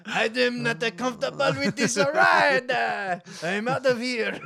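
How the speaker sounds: loud, laughing mock panic shout; panicked shout. Frenchman panicking in the jet, loud laughing shout. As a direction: yell it like a man bailing out of a jet.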